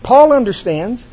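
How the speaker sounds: high, loud preaching climb; fiery sermon build, pitched high. Acted. Preaching at full force.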